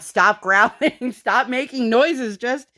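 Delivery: retold exasperated pleading; exasperated mom. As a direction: beg the kid to stop, stretching the words. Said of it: "I'm like".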